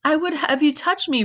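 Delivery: high-pitched, pleading repetition; pitiful echo. A narrator's reading. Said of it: "repeated poor Echo".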